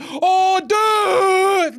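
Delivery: high, loud drawn-out yell; loud exasperated outburst; exasperated shout. Real, not acted. Exasperated outburst at trade news.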